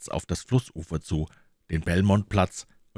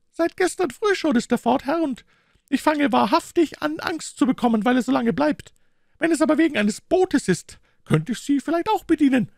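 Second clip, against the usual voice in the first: high-pitched, worried woman's character voice; worried boatman's wife; 5 dB louder than usual. A narrator's reading. Wife tells a gentleman her husband is missing, high worried voice. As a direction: fret aloud in a much higher voice.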